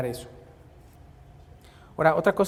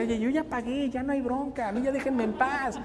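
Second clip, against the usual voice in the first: put-on confident little girl; 6 semitones higher than usual. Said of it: "con una seguridad la chavita decía".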